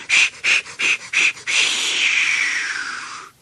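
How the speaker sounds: whispered shh puffs fading away; unvoiced chuffing dying off; fading engine puffs. A narrator's reading. Puffing fades.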